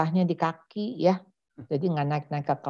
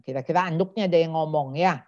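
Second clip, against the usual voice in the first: normal talking voice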